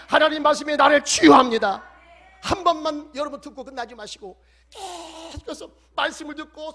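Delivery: rising, shouted sermon proclamation; fiery preaching. Acted. Pastor exhorting the congregation, preaching at full force. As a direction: preach it out, building to a roar.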